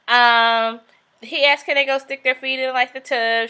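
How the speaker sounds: normal talking voice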